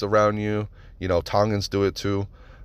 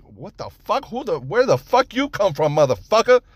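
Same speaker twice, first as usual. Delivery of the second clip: high, indignant outburst; acted outraged challenge; 10 semitones higher, 3 dB louder than usual. Startled resident confronting strangers, acted indignant outburst. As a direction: build to a loud final swear word.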